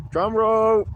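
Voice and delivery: high, loud, drawn-out fanfare; mock drum-roll announcement. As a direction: call it out to build suspense. Mock build-up before reveal.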